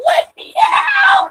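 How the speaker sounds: very high, drawn-out scream; screamed plea. Acted. Voicing the trapped cat demanding out, long high scream. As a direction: scream it long and shrieking to be freed.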